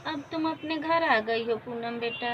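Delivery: plain reading voice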